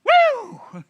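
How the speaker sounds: very high, loud whoop of joy; mock whoop. Preacher voicing the girl springing up, loud whoop. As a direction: shout it like springing up from bed.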